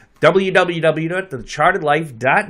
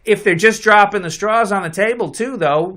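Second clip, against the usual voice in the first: usual speaking voice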